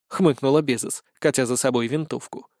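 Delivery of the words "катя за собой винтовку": ordinary delivery, nothing marked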